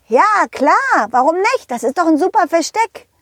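put-on high, proud boy's voice; self-assured kid, pitched high; cocky little pirate. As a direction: answer brightly, sure of your brilliant idea. Pirate boy proud of hiding place.

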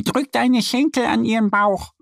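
high duck voice, helpful; patient advice from a friend; explaining little duck. A narrator's reading. Annie explaining to Mo how to start the horse, high duck voice. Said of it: "erklärt Annie".

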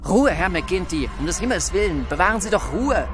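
urgent calling out. A narrator's reading. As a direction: call out to stop a fight. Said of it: "rief er".

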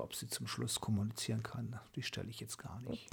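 whispered joke. Real, not acted. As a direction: whisper it as a playful aside. Flüsternder Scherz. (geflüsterter Scherz)

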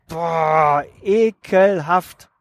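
drawn-out, very slow groan of revulsion; disgust. Real, not acted. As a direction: stretch it out as if something smells bad. Disgust at old sayings.

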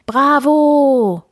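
loud, high, drawn-out cheer; cheering roar. A narrator's reading. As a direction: holler it like a roaring circus crowd. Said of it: "brüllte das Publikum". (begeistert gebrüllt)

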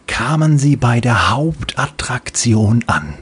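ordinary delivery

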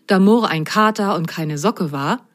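ordinary delivery, nothing marked

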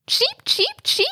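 very high bird chirps; budgie chirp. Acted. (hohes Wellensittich-Zwitschern)

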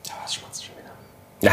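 hushed, whispery interjection; whispered aside. Real, not acted. Podcaster catching smacking noises on air, whispered aside. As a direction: break off and whisper it under your breath.

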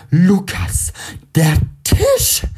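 shocked shout; startled cry. A narrator's reading. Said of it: "ruft sie erschrocken".

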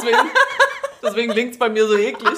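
stuffy blocked nose voice. Real, not acted. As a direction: talk through a blocked nose. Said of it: "ich krieg keine Luft durch die Nase".

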